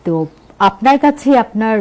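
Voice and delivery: usual speaking voice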